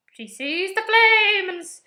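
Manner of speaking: loud, high, drawn-out mocking wail; hammed-up prophecy, slow and loud; mock mystical. Mocking the Red Witch's claims.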